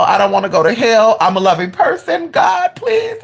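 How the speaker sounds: pleading that climbs to a high wail; imitated crying out. Storyteller replays begging God about hell, crying out. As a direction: act out begging, rising sharply at the end. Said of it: "I was crying out".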